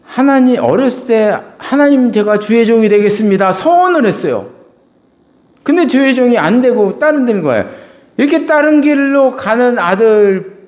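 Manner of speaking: loud, high preaching cry; full-force preaching. Acted. Preacher crying out to his congregation, loud and high. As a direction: preach it out to the back row.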